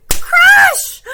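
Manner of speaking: loud, high crash exclamation; shouted crash. A narrator's reading. Storyteller as the chair breaks, shouted crash. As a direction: shout it like a sudden smash.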